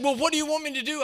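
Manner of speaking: high, fast mock exasperation; retold exasperation. Angry director demands instructions from her pastor, high and fast. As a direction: imitate an angry woman demanding an answer. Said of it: "came in my office so mad".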